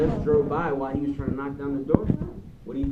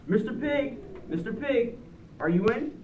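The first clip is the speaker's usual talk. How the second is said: calling out; 8 semitones higher than usual. Acted. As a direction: call through the door to the pig. Wolf calls at the door.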